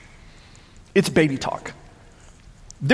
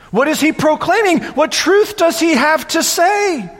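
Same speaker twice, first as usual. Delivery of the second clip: scornful mockery; 10 semitones higher than usual. Mocking voice of the scoffers.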